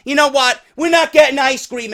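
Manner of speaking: loud, high fed-up outburst; angry father. Acted. Father cancelling the family's ice cream, loud and fed up. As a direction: give up loudly and lay down the law. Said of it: "You're this father".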